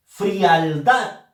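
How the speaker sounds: "frialdad" with a loud, punched DAD; teacher sounding out "frialdad", weight on DAD; stress on final DAD. Shown as a demonstration. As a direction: hit the last syllable of "frialdad" hard. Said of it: "lleva más fuerza de voz es dat".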